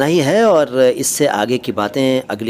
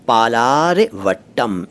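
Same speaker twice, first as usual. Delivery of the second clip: पालारवट्टम broken slowly into parts; पालारवट्टम drawn out for learners; slow sounding-out; much slower than usual. Shown as a demonstration. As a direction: stretch पालारवट्टम out, pausing before the last syllables. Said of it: "इस station का नाम है".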